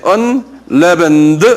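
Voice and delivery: unleavened drawn out slowly, each syllable clear; slow pronunciation demo. Shown as a demonstration. Teacher shows students how to say unleavened, slowly. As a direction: model unleavened slowly for the class. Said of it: "이건 좀 발음이 힘들어요".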